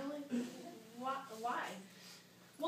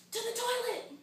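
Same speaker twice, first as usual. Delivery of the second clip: high, insistent cry; urgent command; 9 semitones higher, 4 dB louder than usual. Acted. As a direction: order it in a panic. Acted urgent order to helper.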